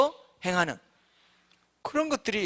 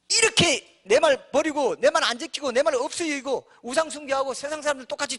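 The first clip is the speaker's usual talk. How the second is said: a scolding God, pitched higher; God's stern warning; 7 semitones higher than usual. Retold or imitated. Preacher voicing God threatening his people, stern scolding. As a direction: warn like an exasperated father threatening. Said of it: "얼음장을 놓는 거죠".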